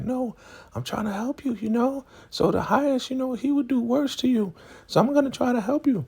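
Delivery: very high falsetto insistence; mock pleading helper. Zane telling Elder Mother she's helping, high mock plea. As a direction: imitate a squeaky, earnest young woman.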